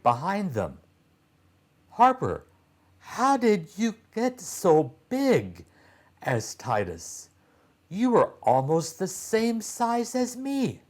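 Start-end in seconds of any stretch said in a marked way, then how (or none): 1.9-5.7 put-on amazed boy. Titus amazed, much higher. 7.9-10.8 put-on amazed boy. Titus continues high.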